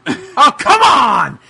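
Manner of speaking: Job-style exasperated yell. Acted. Friend says he sounds like Job, so he yells Job-style. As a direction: whine it like a fed-up showman. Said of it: "You sound like Job yelling".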